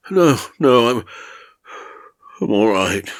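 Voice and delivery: breathless croak of a frightened man; understated hoarse croak. Acted. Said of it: "he said, croaked rather".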